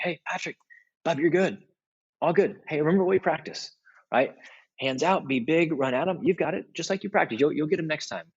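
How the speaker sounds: a lightly voiced cheerful coach; encouraging coach. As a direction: pick the kid up after a goal. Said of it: "One was calling them up".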